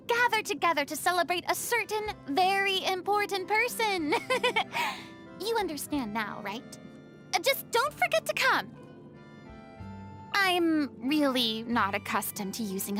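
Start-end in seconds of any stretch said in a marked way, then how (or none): 4.2-5.0 short, high little giggle; coy giggle. Acted. Hinting at the listener's surprise party, coy giggle. 7.4-8.7 high, quick urging; flustered insistence. Acted. Urging the listener to attend the surprise, insistent.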